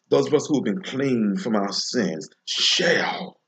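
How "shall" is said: "shall" set apart, pitched high and falling; stress on shall. Real, not acted. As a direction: land on "shall" as a weighty word on its own. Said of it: "the word shall to be significant".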